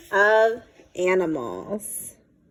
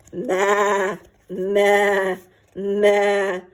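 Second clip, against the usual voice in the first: sheep bleats; much slower than usual. A narrator's reading.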